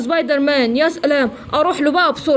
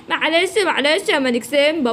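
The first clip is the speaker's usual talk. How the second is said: plain reading voice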